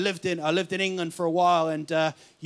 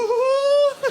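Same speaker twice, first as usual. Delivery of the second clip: high, loud whooping cackle; a wild shrieking laugh; audience shriek; 6 dB louder than usual. Real, not acted. Northern lady in the crowd, shrieking laugh. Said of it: "That was the call of the northern girl".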